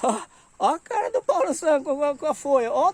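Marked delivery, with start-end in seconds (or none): none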